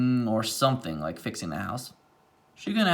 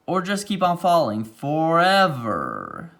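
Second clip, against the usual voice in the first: dramatic reading; 3 semitones higher, 4 dB louder, slower than usual. A narrator's reading. Dramatic narration climax.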